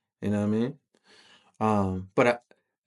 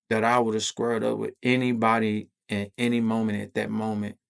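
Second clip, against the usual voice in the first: ordinary delivery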